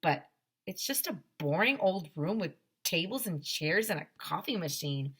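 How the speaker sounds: usual speaking voice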